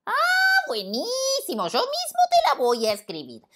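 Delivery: high, slow squeaky monkey voice; cheeky monkey. Acted. Monkey answering the lion, high squeaky monkey voice. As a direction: play a playful little monkey.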